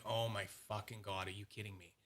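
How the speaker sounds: low, hushed exasperated aside. Retold or imitated. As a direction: sink into a quiet, disbelieving grumble. Exasperated reaction to undeliverable package.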